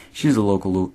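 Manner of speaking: normal talking voice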